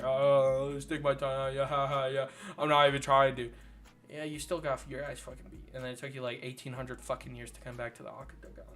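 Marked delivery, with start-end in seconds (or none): 0.0-3.5 low, goofy imitation of a taunt; mock taunting. Fan mocking the fighter's antics in the cage, taunting. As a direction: mimic a fighter clowning around. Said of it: "He was too busy taunting".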